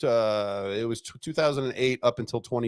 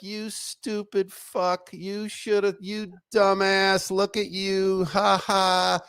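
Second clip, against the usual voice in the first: slow, sneering ridicule, a touch higher; lightly voiced jeering colleagues; mild mock taunt; 6 semitones higher, much slower than usual. Colleagues jeering at him, in slow, higher mock ridicule. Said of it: "everybody was like".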